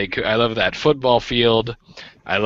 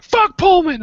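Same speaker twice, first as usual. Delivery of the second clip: high, loud reflex yell; yelled outburst; 6 dB louder than usual. Retold or imitated. Acting out his reflex at hearing Pullman, yelling. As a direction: yell it out like a trigger went off. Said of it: "I'll just yell it out".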